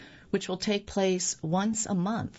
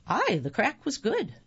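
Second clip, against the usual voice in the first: put-on Irish accent; 5 semitones higher than usual. Mock Irish pub chat reply.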